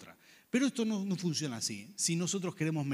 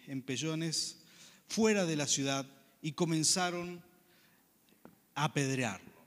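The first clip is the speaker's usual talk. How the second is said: ordinary talk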